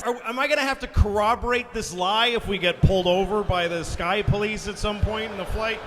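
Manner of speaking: ordinary talk, nothing marked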